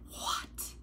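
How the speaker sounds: breathless whispered disbelief; stunned whisper at a before and after picture; whispered what. Retold or imitated. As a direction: breathe it in awe. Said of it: "I'm like".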